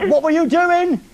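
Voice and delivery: ordinary delivery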